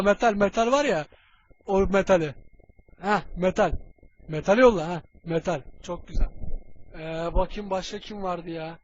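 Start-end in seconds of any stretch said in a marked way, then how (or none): none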